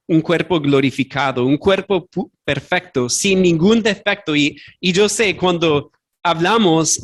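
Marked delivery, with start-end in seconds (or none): none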